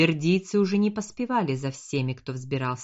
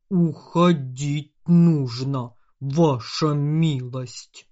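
slow, loud dwarf urging retreat; a dwarf pleading to withdraw; 6 dB louder, much slower than usual. A narrator's reading. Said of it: "Этот голос принадлежал Фундинулу".

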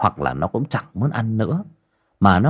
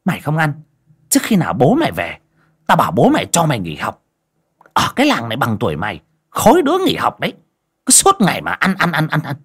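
quick, nagging angry tirade; scolding threat; 4 semitones higher, faster than usual. A narrator's reading. Stepmother threatens to pull girl from school, scolding. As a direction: rattle off the threat in irritation.

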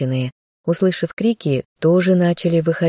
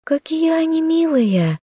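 put-on delighted young girl; 13 semitones higher than usual. A narrator's reading. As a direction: coo with delight at cute animals. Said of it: "заулыбалась Маша". (восторженно, тонким детским голоском)

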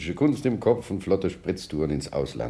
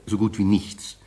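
ordinary delivery

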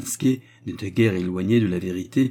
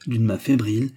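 usual speaking voice

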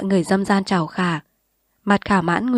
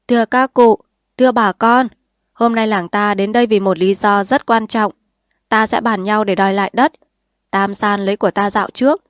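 formal public address; 2 semitones higher, 5 dB louder than usual. Acted. Lão Bong addresses the village.

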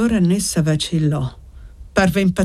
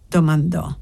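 ordinary delivery

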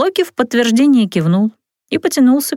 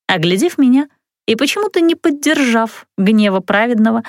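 ordinary delivery, nothing marked

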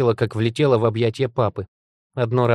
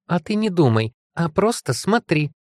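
high, sing-song sarcasm; caustic; 7 semitones higher than usual. A narrator's reading. Ёлка retorting to doubting Феликс, caustic. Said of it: "язвительно отозвалась Ёлка".

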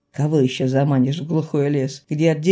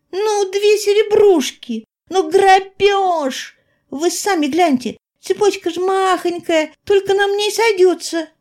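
put-on wheedling girl; 13 semitones higher, 4 dB louder than usual. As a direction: whine and bargain like a pestering girl. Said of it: "клянчила маленькая светленькая волчица".

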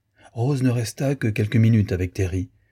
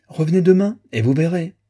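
calling out; 9 semitones higher, 4 dB louder than usual. Acted. Calling after departing children.

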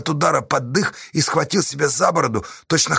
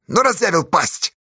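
higher, irritated grumble; angry grumble; 7 semitones higher than usual. A narrator's reading. Fedka at the loudmouth, angry grumble. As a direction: grumble it crossly. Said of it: "зло буркнул Федька".

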